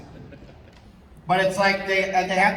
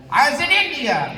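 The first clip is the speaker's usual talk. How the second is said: higher, louder storyteller's announcement; mock grand storyteller; 8 semitones higher, 4 dB louder than usual. Shown as a demonstration. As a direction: announce it like a pompous explorer. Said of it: "you can be the other guy, just repeat the last".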